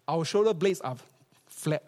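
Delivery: usual speaking voice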